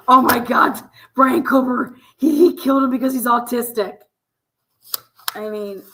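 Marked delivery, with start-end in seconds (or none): 0.1-4.0 mock gasp. Mocking media reaction.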